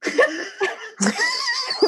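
high, helpless burst of laughter; squealing laugh; shrieking laugh. Real, not acted. Mom doesn't know WAP.

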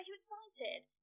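hushed, high-pitched hype-up; egging her on. Retold or imitated. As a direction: coax her into mock excitement. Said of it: "egg it up, I'm like".

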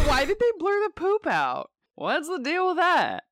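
high-pitched mock voice of a guy; imitating Kevin. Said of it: "He's like".

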